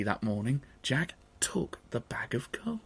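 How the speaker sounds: unvoiced hushed telling; whispered narration. A narrator's reading. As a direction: whisper as Jack sneaks. Sneaking narration.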